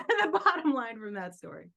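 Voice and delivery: ordinary talk, nothing marked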